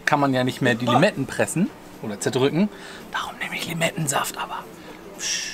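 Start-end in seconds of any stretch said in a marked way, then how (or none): 3.1-5.4 whispering. Real, not acted. Telling a secret about lime juice.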